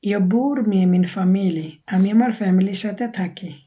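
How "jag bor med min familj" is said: very slow, careful Swedish sentence; slow model phrase. Shown as a demonstration. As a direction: spell it out slowly for learners. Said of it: "with, ইংলিশে যেটা আমরা বলি". (খুব ধীরে Swedish বাক্য)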